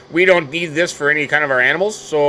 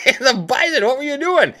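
laughing at himself; 11 semitones higher than usual. Real, not acted. Laughing at own mistake in game.

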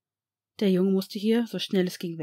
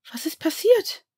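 breathless gasp, pitched high and louder; 10 semitones higher, 5 dB louder than usual. Acted. Narcissa gasps at the sick child, breathless alarm. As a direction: gasp it out in shock. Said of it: "keuchte Narcissa".

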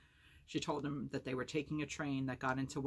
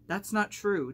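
loud, high, angry denial; snapping; 7 semitones higher, 10 dB louder, slower than usual. A narrator's reading. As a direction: snap it back sharply, raising the voice. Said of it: "Dicey snapped".

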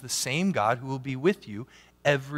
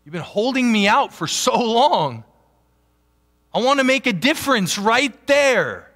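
higher, louder pleading voice of God at the door; God knocking; 8 semitones higher, 5 dB louder than usual. Acted. Preacher voicing God knocking at the heart's door, pleading louder. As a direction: ask to be let in, rising and louder. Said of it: "God's knocking at the door going".

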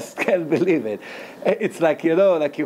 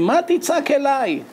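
higher, pointed rebuke; a mock-divine rebuke, pitched up; reproachful question; 9 semitones higher than usual. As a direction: challenge him, why cry out now. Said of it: "and Hashem said to him".

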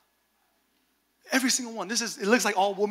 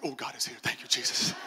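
low, soft aside to God; recalled prayer murmured low under the breath; hushed thanks; 8 semitones lower, 4 dB quieter than usual. Retold or imitated. Preacher recalling his relief in a jail cell, hushed thanks.

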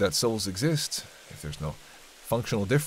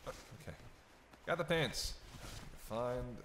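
muttering; 3 semitones higher, 14 dB quieter, slower than usual. Acted. Muttering while searching.

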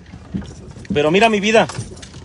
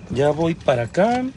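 slightly hoarse voice; a bit husky from a sore throat; 3 semitones lower, 4 dB quieter than usual. Real, not acted. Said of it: "ando medio ronco".

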